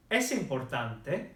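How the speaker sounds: slow "es importante" with a final rise; teacher's slow rising question on "es importante"; questioning intonation. Shown as a demonstration. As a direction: ask "es importante" clearly, lifting the ending. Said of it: "I'll ask you the question again".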